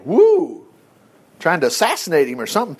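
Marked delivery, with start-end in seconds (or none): 0.1-0.6 excited whoop. Real, not acted. Excited by the psalm.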